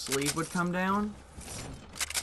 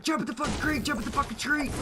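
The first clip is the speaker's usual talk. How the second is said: panicked yell, way up high; urging the burning friend into the creek, high-pitched yell; high panicked shouting; 12 semitones higher, faster than usual. Retold or imitated. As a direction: scream the order at him.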